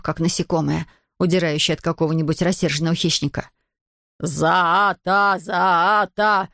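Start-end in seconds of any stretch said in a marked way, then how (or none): 4.2-6.5 drawn-out alien warning cry; odd creature voice, stretched vowels; creature shooing someone away. A narrator's reading. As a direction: shoo her off in a creature voice. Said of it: "отгонял он её".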